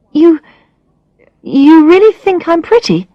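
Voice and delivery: high, disbelieving rise; amazed question. Acted. Alex stunned that Brad finds her pretty, high amazed question. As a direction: ask it half-thrilled, half-unsure.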